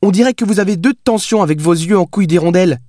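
put-on slightly high voice of a sassy young woman; lightly voiced cheeky Billy, mocking. A narrator's reading. Billy makes fun of the dazed writer, lightly mocking.

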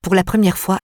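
plain reading voice

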